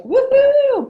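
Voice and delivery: high, loud little whoop; mock cheer. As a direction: cheer over the points. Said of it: "And she's like".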